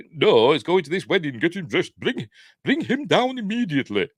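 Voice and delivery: high-pitched, imperious put-on accent; mock-commanding in an exaggerated put-on accent; Lee Remick put-on accent. Imitating Kathy ordering Damien dressed, high hammed-up accent. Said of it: "But not in that sort of accent".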